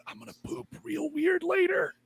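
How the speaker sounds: high silly confession; goofy Hutch. Acted. Hutch tells friends about cheese, squeaky. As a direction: blurt it out in a squeaky voice. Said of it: "he's like".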